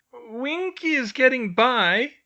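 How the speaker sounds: slow, high tearful elf; sniffling elf. A narrator's reading. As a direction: say it through a sniffle, slowly. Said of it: "she said with a sniffle".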